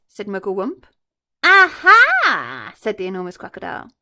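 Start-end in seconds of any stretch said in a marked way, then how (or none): 1.4-2.7 much higher, louder gloat; spring the reveal; triumphant aha. Acted. Crocodile gloating to the monkey, triumphant.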